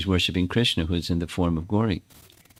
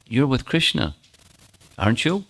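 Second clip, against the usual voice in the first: higher, pointed accusing question; a suspicious husband's lightly voiced accusation; 5 semitones higher than usual. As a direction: press her for the truth. Abhimanyu accusing Radha.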